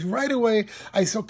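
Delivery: ordinary talk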